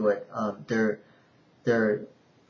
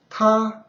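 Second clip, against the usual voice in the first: TA high and level; first tone; 9 semitones higher, 5 dB louder than usual. Shown as a demonstration. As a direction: hold TA on one high, even note.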